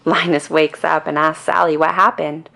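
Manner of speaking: ordinary delivery, nothing marked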